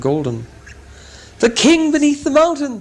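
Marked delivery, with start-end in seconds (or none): 1.4-2.8 joyful shout, much higher and louder. Acted. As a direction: call it out to the whole town. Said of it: "they shouted".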